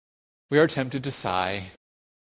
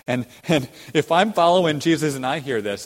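ordinary talk, nothing marked

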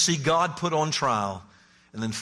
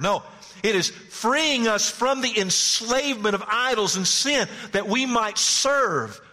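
high, driving preaching voice; 8 semitones higher, 4 dB louder than usual. Acted. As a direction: build it up and preach. Preaching at full force.

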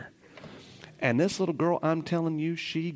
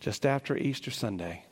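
choked up; 3 semitones lower, 5 dB quieter than usual. Real, not acted. Emotional recollection, voice drops.

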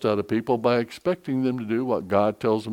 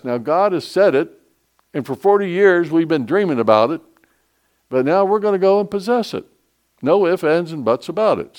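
louder, higher preaching push; rising sermon; 5 semitones higher, 5 dB louder than usual. Acted. Pastor rallying his church to take the land, building preaching. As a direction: drive the promise home to the congregation.

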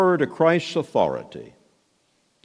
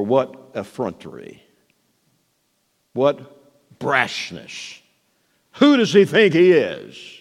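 indignant scoff; much slower than usual. Retold or imitated. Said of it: "They had to have thought".

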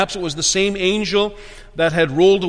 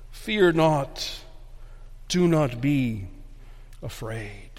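quiet reassurance; 2 semitones lower, 5 dB quieter, slower than usual. Real, not acted. Preacher calming the flock after the climax, quiet reassurance. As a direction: let each phrase settle softly.

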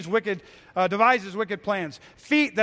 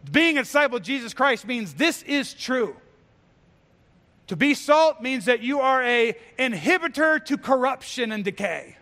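high, loud pulpit proclamation building to shouts; preaching at full force; 6 semitones higher, 5 dB louder than usual. Acted. Preacher telling believers they are salt and light, fiery preaching. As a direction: declare it to them like a rally cry.

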